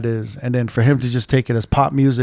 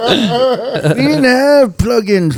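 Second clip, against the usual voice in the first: put-on high, creaky old-timer voice laughing through it; old man voice; 11 semitones higher, 4 dB louder, slower than usual. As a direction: play a cranky old man reminiscing. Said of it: "Give me the old man voice".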